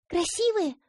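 put-on high eager child question. A narrator's reading. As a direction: ask brightly like a little girl. Girl asks. (детский голос)

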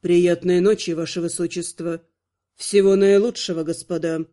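low, courteous vampire lord; bow out graciously; polite farewell. A narrator's reading. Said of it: "Вежливо попрощался он".

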